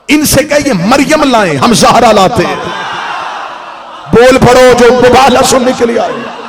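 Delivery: very loud, high-pitched sermon climax; full-force preaching. Acted. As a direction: thunder the challenge to a roused congregation.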